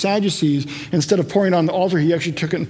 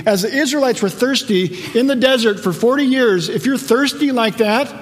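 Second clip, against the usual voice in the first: raised, emphatic proclamation; Jesus; 4 semitones higher than usual. Acted. Jesus calling to the celebrating crowd at the altar, impassioned. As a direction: preach it out as a bold declaration. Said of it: "Jesus is, in a sense, saying".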